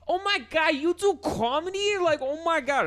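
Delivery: very high, gushing mock Brooklyn girl; imitated girlfriend. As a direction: squeal it as an impressed New York girl. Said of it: "and she was like".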